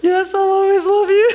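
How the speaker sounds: hammed-up, very high, choked-up voice; trying not to cry. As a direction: squeak it out loudly, mock-fighting back tears. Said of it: "like, trying not to cry".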